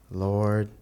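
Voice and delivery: ordinary talk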